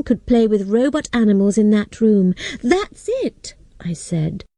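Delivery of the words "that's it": high, eager burst of discovery; excited realization. A narrator's reading. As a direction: blurt out the idea with delight. Girl has a sudden idea.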